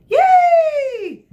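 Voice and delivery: put-on high, loud kids' cheer. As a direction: whoop with joy.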